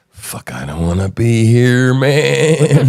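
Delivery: drawn-out mock complaint, rising at the end; voiced reluctant officer. Host voices the officer at the protest, reluctant. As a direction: lightly play an officer who wants out. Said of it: "He's standing there. He's going".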